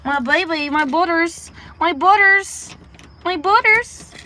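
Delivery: very high, loud cooing. Real, not acted. Owner greeting cat George after being away, high baby talk. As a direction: squeal little greetings to a beloved pet.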